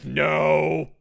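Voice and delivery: loud, drawn-out monster refusal; imitated monster's protest. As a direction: bellow it slowly as the creature. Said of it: "He's like".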